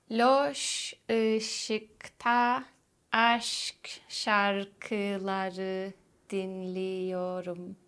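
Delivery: "Loş ışıkta aşk şarkıları dinliyorum" said very slowly, word by word; slow demo. Shown as a demonstration. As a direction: spell out "Loş ışıkta" slowly, each sound clear.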